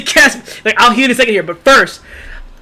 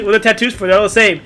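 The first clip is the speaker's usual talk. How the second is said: usual speaking voice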